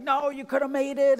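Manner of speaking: hammed-up sideline protest; mock fan outburst. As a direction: imitate a parent yelling at the coach. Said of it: "You're like".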